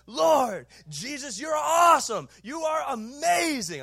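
loud, high, passionate prayer cry; shouted prayer. Retold or imitated. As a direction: belt it out like praying from the platform. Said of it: "and I'm going".

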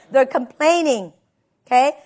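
ordinary talk, nothing marked

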